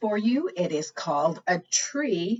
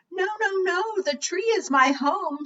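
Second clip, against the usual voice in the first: high little bird voice pleading; high tiny chick voice; baby bird; 11 semitones higher than usual. Acted. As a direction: squeak it out like a tiny bird protecting its home. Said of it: "the baby bird".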